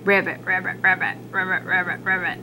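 low frog voice going ribbit; frog croak. Acted.

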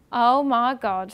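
slow, loud stunned oh my God; overwhelmed gasp. Retold or imitated. Retelling the BBC call, a loud slow stunned gasp. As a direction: let it spill out in disbelief. Told she got BBC job.

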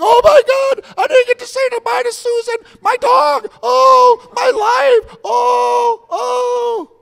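hammed-up panic cry; panicked passengers. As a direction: wail like terrified passengers.

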